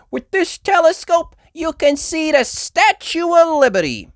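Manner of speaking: high, loud tour guide voice; proud guide announcing; tour guide Miss Rabbit. Acted.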